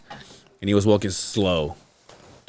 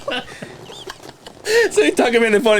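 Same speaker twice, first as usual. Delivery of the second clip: ordinary talk, nothing marked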